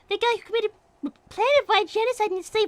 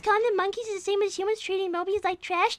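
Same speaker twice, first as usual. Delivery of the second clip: normal talking voice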